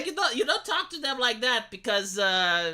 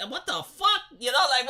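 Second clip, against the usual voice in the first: ordinary talk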